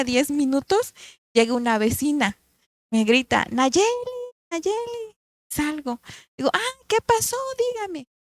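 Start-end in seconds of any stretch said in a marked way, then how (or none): 3.5-5.1 neighbor calls out, second call much higher; calling out a name. Retold or imitated. Neighbor calls her name outside. 6.5-8.1 high, bright question to the neighbor; surprised answer. Retold or imitated.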